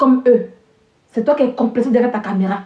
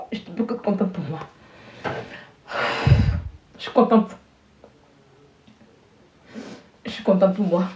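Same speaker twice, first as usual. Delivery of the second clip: low, soft, emotional; quietly moved; 6 semitones lower, 8 dB quieter than usual. Real, not acted. Student taking in her success, quietly moved. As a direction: say it softly to yourself, moved. Moved after crying for joy.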